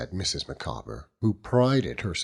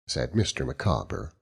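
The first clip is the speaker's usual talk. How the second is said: plain reading voice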